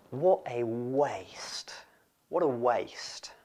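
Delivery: slow, whispered dismay; disapproving whisper. Acted. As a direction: whisper it slowly, lamenting the waste. Voicing the disciples' muttered disapproval.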